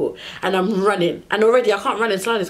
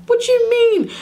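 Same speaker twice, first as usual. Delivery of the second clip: much higher, louder disbelieving yell; outraged shout; 5 dB louder than usual. Retold or imitated. Arguing with airport staff about the gone plane, outraged yell. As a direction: shriek it at them, outraged.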